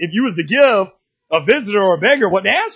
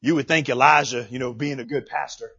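ordinary talk, nothing marked

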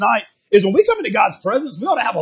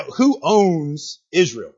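drawn-out, measured question; slow question; 3 semitones lower, much slower than usual. Real, not acted. Preacher quizzing the congregation, slow question. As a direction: ask it slowly, stretching the words. First asking, audience answers weakly.

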